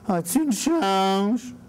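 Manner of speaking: slow, drawn-out begging voice; panhandler. Acted. Beggar asking someone leaving the liquor store, drawled plea. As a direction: draw the plea right out.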